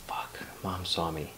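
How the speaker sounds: near-whispered aside to himself; hushed retold thought. Boy realizing mom spotted him, hushed dismay.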